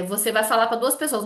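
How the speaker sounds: ordinary delivery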